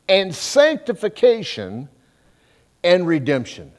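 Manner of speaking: slow, high, loud declaiming of the verse; scripture reading. Acted. Preacher reads verse thirty to the congregation, slow and declaiming. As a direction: proclaim each word of the verse.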